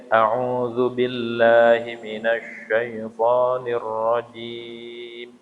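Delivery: slow chanted ta'awudz; Quran recitation. Shown as a demonstration. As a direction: recite it slowly with tajwid. Recites taawudz slowly.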